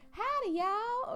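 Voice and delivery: very high, loud, drawn-out country greeting; high-pitched country voice. Shown as a demonstration. Showing a high-pitched country greeting, piercingly high. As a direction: squeal it out in a country accent. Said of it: "especially if they have a high-pitched voice".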